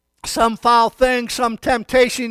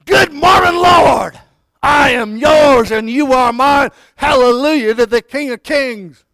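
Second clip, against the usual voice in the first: joyful shout; 4 semitones higher, 5 dB louder than usual. Shown as a demonstration. Preacher shows the right way to greet God, shouting. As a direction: burst out with joy at dawn. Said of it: "get up and say".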